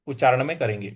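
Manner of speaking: usual speaking voice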